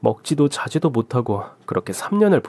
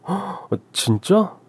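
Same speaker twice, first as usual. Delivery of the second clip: slow, much higher amazed reply; delighted surprise at the prize news; father amazed at daughter's first prize; surprised delight; 7 semitones higher, much slower than usual. Acted.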